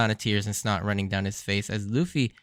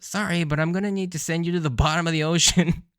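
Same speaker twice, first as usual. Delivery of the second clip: put-on higher, breezy menacing old man; casual threat; 6 semitones higher than usual. Reviewer quotes Garp's casual threat to the crew, breezy. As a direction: toss off the threat lightly like Garp. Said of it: "his casual threat of".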